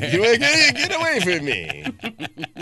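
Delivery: high, drawn-out mock panic; freaked-out husband. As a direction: fend off licking in a comic wail. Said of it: "you're freaking me out".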